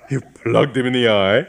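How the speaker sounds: rising, astonished question; can't believe what he just heard; shocked disbelief. Acted. Augustine shocked, repeating.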